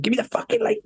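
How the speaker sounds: high-pitched mock-furious demand; dad going nuts. Old man demands the lighter from his boy, mock furious. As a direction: imitate an irate father demanding it. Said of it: "my old man went nuts again".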